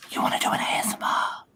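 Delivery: excited hushed whisper; breathy whisper. Real, not acted. Proposes ASMR, whispers.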